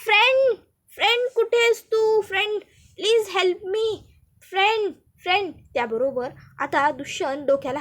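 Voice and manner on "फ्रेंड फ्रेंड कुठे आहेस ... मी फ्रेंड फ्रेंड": calling out. Acted. Pooja calling Dushyant for help, high pleading calls. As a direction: call out to him, pleading for help. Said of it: "ती लगेच दुष्यंतला हाका मारते".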